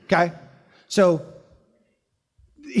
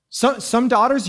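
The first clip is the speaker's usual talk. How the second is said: ordinary talk, nothing marked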